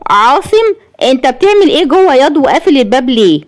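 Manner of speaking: high-pitched shocked questioning. A narrator's reading. Mother finding son behind locked door, shocked. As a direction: question him in high-voiced disbelief. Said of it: "قالت بصدمة".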